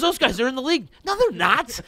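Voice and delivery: high, loud back-and-forth; hollered comic exchange; retold shouted argument. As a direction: re-enact friends yelling over each other. Acted shouted exchange in retold story.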